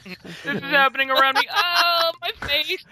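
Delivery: high, loud, panicky fake British accent; hammed-up fake British accent. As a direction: overplay a bad British accent in mock alarm. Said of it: "super bad, totally fake accent".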